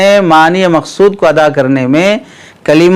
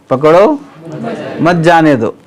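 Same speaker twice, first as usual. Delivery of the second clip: पकड़ो rising, then a break before मत जाने दो; pause after पकड़ो. Shown as a demonstration. Teacher shows students the king's intended reading, pause after पकड़ो. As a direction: split off पकड़ो, keep मत जाने दो together and falling. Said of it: "तो बादशाह की मुराद है".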